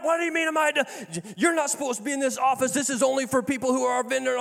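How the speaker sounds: high, fast imitation of an outraged woman; hammed-up offended scolding; mock indignant yell. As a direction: play her outrage, rushing. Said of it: "yelling at me".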